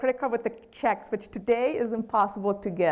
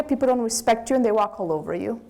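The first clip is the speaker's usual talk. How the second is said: normal talking voice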